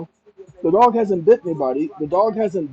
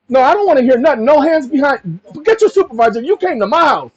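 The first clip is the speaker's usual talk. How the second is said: higher and louder, cutting him off; raised, heated demand; 8 semitones higher, 5 dB louder than usual. Pretend. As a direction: demand the supervisor, no backing down. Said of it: "that's my conversation".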